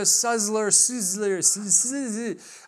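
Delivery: Suzzler, Suzler, tripping over buzzing z sounds; mock attempts at Suzzler and Suzler with z for s; mocking mispronunciations. Shown as a demonstration. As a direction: garble the surname as Suzzler, then Suzler. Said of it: "nobody can say my last name right".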